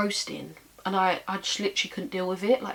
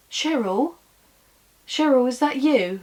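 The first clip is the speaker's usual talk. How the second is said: higher, louder nan imitation; nan on phone; 6 semitones higher, 7 dB louder than usual. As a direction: call out questioningly as nan on the phone. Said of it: "and she's like".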